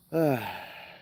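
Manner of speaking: single audible sigh; heavy sigh. Real, not acted. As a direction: let out one big breath. Sighs after sombre remark.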